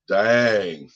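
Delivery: usual speaking voice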